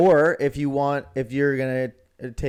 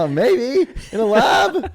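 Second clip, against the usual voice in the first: laughing; 10 semitones higher, 6 dB louder, much slower than usual. Real, not acted. Joking along, laughing.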